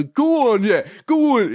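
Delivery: high mock fan cheer; crowd cheering. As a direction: imitate fans egging him on. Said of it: "all the regular fans go".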